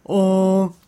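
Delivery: slow, drawn-out goofy confession; dopey character owning up; invented street voice. Shown as a demonstration. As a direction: stretch each word with exaggerated swooping intonation. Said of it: "exactly like I just did it".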